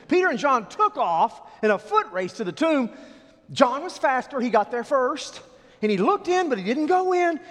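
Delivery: high, loud storytelling push; full-force preaching. Acted. Preacher telling the congregation about the foot race, full force. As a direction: drive the race to the tomb.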